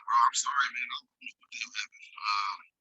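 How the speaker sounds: ordinary delivery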